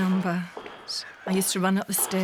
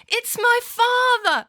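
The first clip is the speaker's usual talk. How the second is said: very high, loud, drawn-out call; retold answering cry; 8 dB louder, slower than usual. Daughter answering her dad in the care home, high loud call. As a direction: echo his call, slow and pitched way up.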